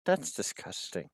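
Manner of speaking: low, very soft sidelong remark; quiet aside. Acted. Player in character to teammate Kit, hushed aside. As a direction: drop the voice right down for a private jab. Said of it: "Aside to Kit".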